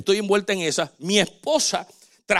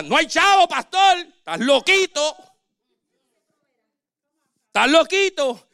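much higher, louder teasing voice; higher, louder mock of church doubters; 8 semitones higher, 3 dB louder than usual. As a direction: tease the pastor like he's crazy. Congregants doubting the pastor.

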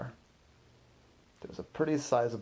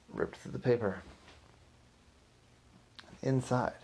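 ordinary delivery, nothing marked

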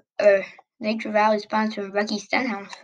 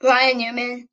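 usual speaking voice

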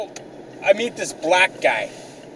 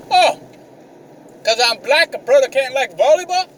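high, indignant rising question; offended accusation; 8 semitones higher than usual. Retold or imitated. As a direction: imitate someone taking mock offence.

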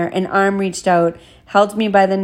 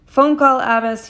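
mild shout, higher and louder at first; screamed call; 5 semitones higher, 3 dB louder than usual. A narrator's reading. Hotel man calls the guest to the phone, mild scream. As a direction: call out as if yelling across a lobby, restrained. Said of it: "he screamed".

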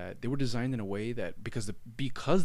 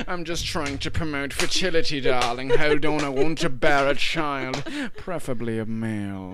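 hammed-up husband; 3 semitones higher, 7 dB louder than usual. Acting out a Victorian husband.

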